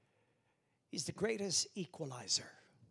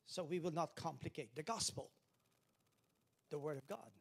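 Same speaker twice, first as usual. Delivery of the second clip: ordinary talk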